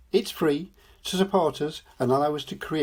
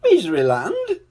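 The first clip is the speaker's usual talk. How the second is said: high, louder, rising disbelief; surprised question; 9 semitones higher, 5 dB louder than usual. A narrator's reading. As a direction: ask "Miseryland?" in astonishment. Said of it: "he said".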